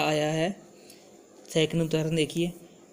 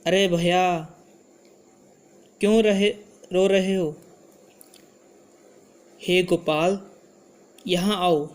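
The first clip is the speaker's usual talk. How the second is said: raised, louder vocative call; 4 dB louder than usual. Shown as a demonstration. Teacher shows students how to address someone, calling out. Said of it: "sound हमने बदलनी है".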